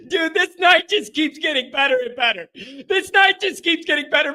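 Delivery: real overjoyed cheer at the win; elated shout. Real, not acted. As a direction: yell it out in pure delight.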